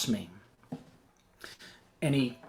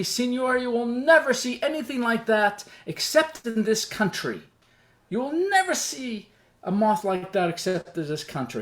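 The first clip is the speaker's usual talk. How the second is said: high, marvelling soldier in broken English; 8 semitones higher than usual. Retold or imitated. Soldier shows off his country's moth, in broken English. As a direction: imitate lightly accented broken English, proud and insistent. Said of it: "he talks in broken English".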